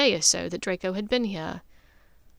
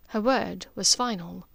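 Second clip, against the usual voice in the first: usual speaking voice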